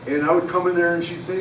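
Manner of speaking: normal talking voice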